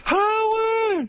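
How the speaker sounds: piercing falsetto call of Howard; High Pitch Eric calling. Retold or imitated. Heckler calling Howard in very high falsetto. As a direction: yell the name way up high. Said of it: "He'd be there".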